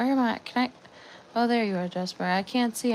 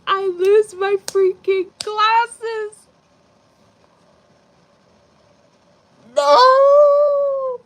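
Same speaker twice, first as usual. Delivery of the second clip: high, loud, slow crying wail; hammed-up mental breakdown wail; mock breakdown; 9 semitones higher, 8 dB louder, slower than usual. Real, not acted. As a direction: melt down theatrically. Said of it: "wanna see a mental breakdown".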